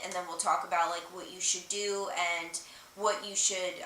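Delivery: usual speaking voice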